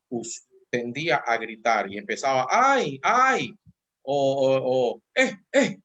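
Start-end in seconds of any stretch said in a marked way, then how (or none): 2.5-3.5 put-on high loud "ay, ay" cry of a toddler; mock toddler whine; imitated child wail. 5.1-5.8 put-on high loud toddler "eh, eh"; mock toddler yell; imitated child yell. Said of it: "hacía así".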